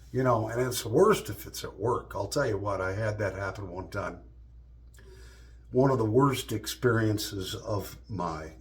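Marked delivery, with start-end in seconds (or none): none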